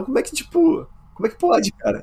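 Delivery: sudden high-pitched disbelief; can't believe what he heard; incredulous. Real, not acted. Espanto com a voz do sótão. (espanto agudo, incrédulo)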